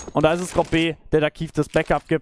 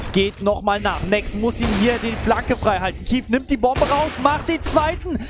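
rising hype, shouting the ace; excited caster losing it over a clutch; esports caster; 11 semitones higher than usual. Real, not acted.

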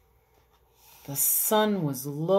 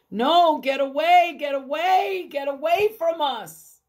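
high, loud fearful shooing; frightened crowd warding off lepers; scared shout; 7 semitones higher, 4 dB louder than usual. Acted. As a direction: push them away in panic.

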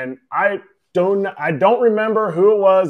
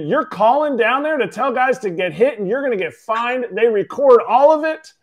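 angry scolding; 6 semitones higher than usual. Retold or imitated. Manager scolding catcher about recorded phones, angry. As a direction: berate him like he should know better. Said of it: "He's pissed".

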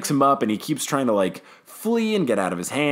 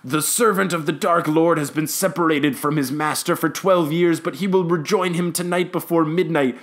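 high, sustained mock-prophecy chant; possessed tirade; 6 semitones higher than usual. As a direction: intone it high like a seer in a trance. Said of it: "weird possessed tirade where her".

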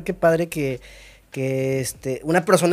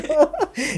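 carcajada aguda y breve; risa aguda; 13 semitones higher, 3 dB louder than usual. Real, not acted. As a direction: suelta una risa aguda. Risa tras la broma. (risa aguda divertida)

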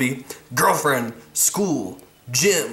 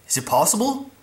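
questioning; 8 semitones higher than usual. Pretend. Rhetorical question before mock answer.

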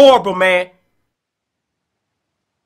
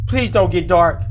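usual speaking voice

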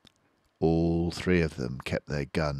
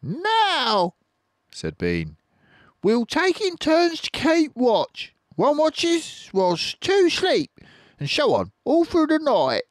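high, louder bossy plan; high farmer voice; 6 dB louder than usual. Acted. Bean to the farmers, squeaky bossy orders.

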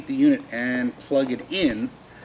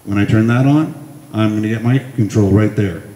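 talking into a handheld mic over music, much louder; through the microphone; 9 dB louder than usual. Shown as a demonstration. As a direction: speak close into the karaoke mic. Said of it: "Microphone's now on".

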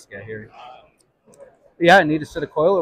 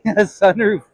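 laughing; 4 semitones higher, 6 dB louder, slower than usual. Real, not acted. Laughing at friend's sunroof joke.

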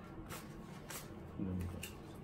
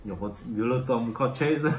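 laughing; 6 semitones higher than usual. Real, not acted. Laughing at embarrassment.

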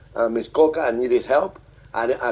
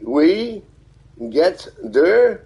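higher and louder, a careful second try at "We get der"; a learner's retry at a German greeting, higher and louder; attempted German greeting; 8 semitones higher, 5 dB louder, much slower than usual. Shown as a demonstration. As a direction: say "We get der" slowly, checking each sound. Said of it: "Am I saying it right".

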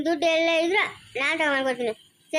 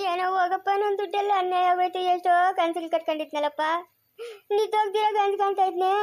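tearful lament. Acted. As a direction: mourn lost dreams with trailing sobby endings. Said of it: "ನಿಲ್ಸು ಕಣ್ಣೀರ". (ಅಳುತ್ತಾ ಹಲುಬುವುದು)

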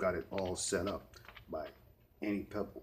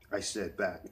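plain reading voice

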